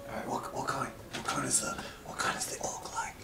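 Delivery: hushed, anxious questioning; scared whisper. Acted. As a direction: whisper it fearing she'll eat you. NPC whispering fearful questions.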